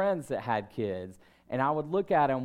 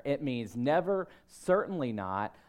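ordinary delivery

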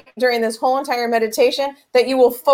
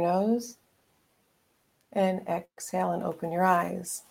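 guided meditation; 3 semitones lower, 4 dB quieter than usual. Acted.

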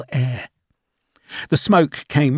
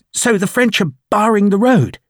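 much higher, louder anxious officer calling out; eager young lieutenant; 8 semitones higher, 5 dB louder than usual. Acted. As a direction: call out breathlessly to the major. Lieutenant's worried question.

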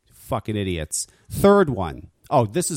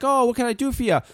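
friendly clerk; 12 semitones higher than usual. Retold or imitated. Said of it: "He's like".

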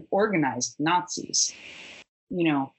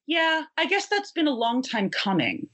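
lightly imitated in a much higher voice; show booker's reply; 9 semitones higher than usual. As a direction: pitch it up to voice the other guy. Booker's casual reply, acted in story.

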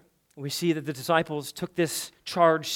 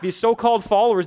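ordinary talk

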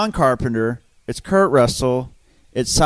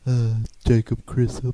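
Jacob impression; 4 semitones lower, slower than usual. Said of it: "here's my Jacob impression".

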